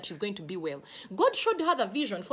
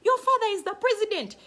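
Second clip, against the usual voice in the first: much higher and louder on father; emphatic declaration; 10 semitones higher, 5 dB louder than usual. Real, not acted. Preacher reassuring the congregation, much higher and louder. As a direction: proclaim it triumphantly. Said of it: "emphatic climax".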